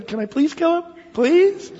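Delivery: mock pleading. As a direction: whine to be allowed to fight, like an eager kid. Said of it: "I'd be there with Abishai saying".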